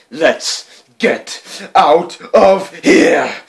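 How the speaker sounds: loud, slow, strained yell; panicked shout. A narrator's reading. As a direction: bellow it while choking. Burglars fleeing choking gas.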